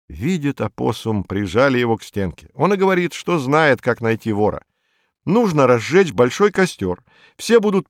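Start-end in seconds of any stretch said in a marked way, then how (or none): none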